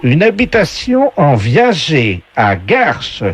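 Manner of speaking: high, slow host announcing prizes; game show voice. Acted. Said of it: "la voix du jeu".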